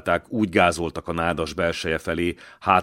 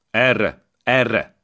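louder, higher beckoning call; odahívó; 5 semitones higher, 5 dB louder than usual. A narrator's reading. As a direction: call them over to follow you. Fiú csalogatja a katonákat. (hívogató, emelt hang)

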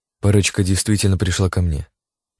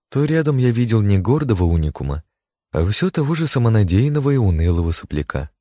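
ordinary delivery

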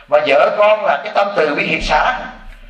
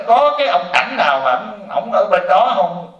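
curious viewer; 5 semitones higher, slower than usual. Retold or imitated. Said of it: "Có nhiều người nói".

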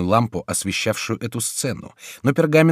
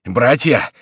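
raised, urgent call; breathless cry; 4 semitones higher, 7 dB louder than usual. A narrator's reading. Mayenne running in to warn the brothers, crying out. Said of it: "вскричал он, запыхавшись".

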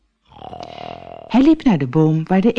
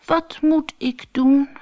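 high, slow worried sigh of a little stoat; 10 semitones higher, slower than usual. Acted. Little stoat sighs about the winter, high and slow. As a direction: sigh it out, lost and helpless. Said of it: "zuchtte Barney".

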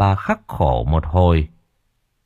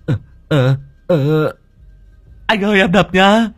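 high, hesitant stammer; nervous servant; 9 semitones higher than usual. Acted. Servant asking before striking, high nervous stammer. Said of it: "Long Đầu Đất run run".

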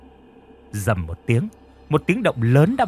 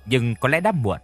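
ordinary delivery, nothing marked

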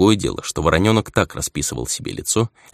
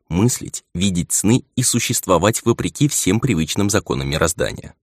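usual speaking voice

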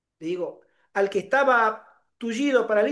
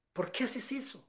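soft, higher-pitched imitation of a friend; hushed reproach; 4 semitones higher, 12 dB quieter than usual. Friend questioning him during service, hushed mimicked reproach. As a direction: murmur a dismayed question under your breath.

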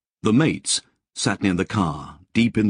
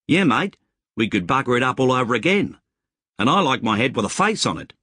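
higher, quicker wisecracking bush mate; joking mate; 6 semitones higher, faster than usual. Acted. Mate opposite the fire mocking the plan, high and quick. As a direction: toss the joke back across the fire, quick and high.

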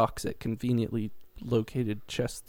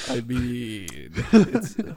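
laughing; 8 dB louder, slower than usual. Real, not acted. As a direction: laugh through the words. Laughing at own joke.

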